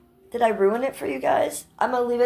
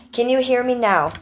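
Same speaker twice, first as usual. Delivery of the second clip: loud, raised-pitch repeat; louder mic check; 4 semitones higher, 9 dB louder than usual. Shown as a demonstration. Streamer checking whether chat hears her, louder. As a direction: project it up and out to test the mic.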